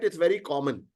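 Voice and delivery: usual speaking voice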